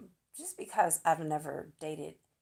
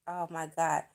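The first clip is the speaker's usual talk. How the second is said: ordinary talk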